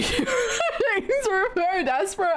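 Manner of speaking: high, slow, giggly; laughing at own voice; laughing through words. Real, not acted. Streamer cracking up mid-sentence.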